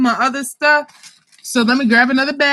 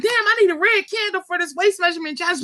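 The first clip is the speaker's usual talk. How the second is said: higher, quick mock customer voice; imitating a customer; 6 semitones higher, 3 dB quieter, faster than usual. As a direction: play a flustered buyer, a bit higher and fast. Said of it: "be like".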